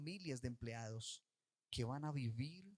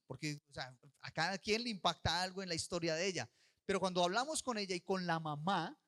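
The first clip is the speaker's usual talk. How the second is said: normal talking voice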